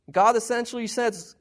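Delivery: ordinary talk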